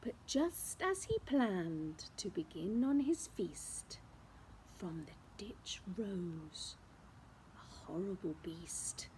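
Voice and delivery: hushed, spooky storytelling; suspense whisper. A narrator's reading. Reading to children before the beast appears, whispered.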